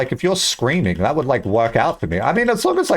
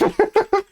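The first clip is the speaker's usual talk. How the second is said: quick squeaky giggle; short high laugh. Real, not acted. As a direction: burst out laughing. Laughs at own lie.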